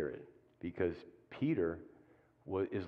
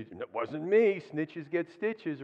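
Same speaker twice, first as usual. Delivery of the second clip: higher, louder, drawn-out protest of innocence; mock indignant denial; 6 semitones higher, 5 dB louder, much slower than usual. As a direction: act out a hammed-up denial, pitched up. Acting out Peter denying being snitch.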